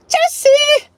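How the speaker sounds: a full scream of Juicy out the window; shouted Juicy. Pretend. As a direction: yell Juicy as loud and high as you can. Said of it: "not a good enough juicy".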